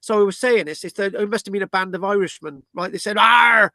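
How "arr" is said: loud, high mock pirate "Arr"; a quick hammed-up pirate bark; cartoon pirate shout; pirate growl. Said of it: "Oh, no, that's a pirate".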